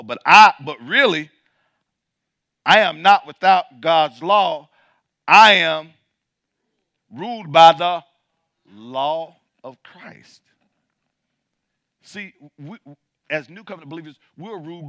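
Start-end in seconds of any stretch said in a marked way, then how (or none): 0.0-10.4 loud declaration, slowing right down on "law of Christ"; preaching shout. Acted. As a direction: proclaim it, then stretch the last words.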